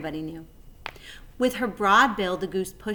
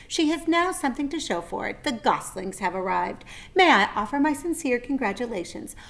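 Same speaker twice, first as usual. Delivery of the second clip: higher, formal spider voice; Charlotte speaking; 7 semitones higher, 4 dB louder than usual. A narrator's reading. Charlotte announcing goslings to the barn, higher. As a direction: announce it graciously.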